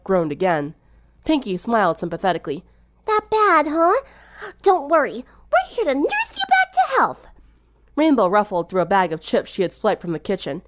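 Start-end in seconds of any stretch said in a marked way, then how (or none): 3.1-7.3 very high, bright, bouncy greeting; high sing-song party girl; chipper. A narrator's reading. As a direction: cheer up a sick friend. Pinkie comforts sick friend.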